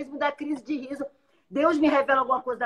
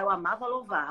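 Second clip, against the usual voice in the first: ordinary delivery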